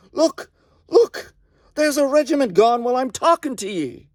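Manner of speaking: can't believe your eyes, point and yell; awestruck excitement. Acted. Soldier awed by falling enemy.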